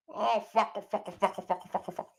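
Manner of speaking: high, soft, rapid-fire clucking; paranoid chicken. Acted. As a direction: cluck the words like a panicking hen. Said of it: "chicken looks up and goes".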